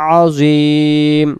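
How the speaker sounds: আজিম said slowly, its ain pressed in the throat. Shown as a demonstration. Teacher showing the throat-pressed ain, slow. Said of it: "এটা আইন অক্ষর গলা চেপে হবে".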